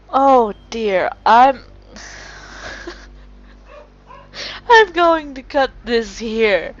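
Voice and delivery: laughing too hard to go on; breaking into laughter. Real, not acted. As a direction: lose it completely, gasping through laughter. Player cracks up at the game.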